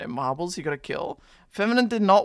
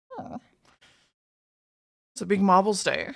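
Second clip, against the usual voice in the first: ordinary talk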